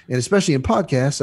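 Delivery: ordinary talk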